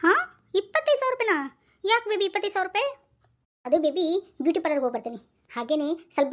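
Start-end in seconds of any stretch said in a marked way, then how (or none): 0.0-2.9 high squeak of disbelief; husband shocked at how much his wife wants, pitched high. Acted. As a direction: gasp at the price. Shocked at amount.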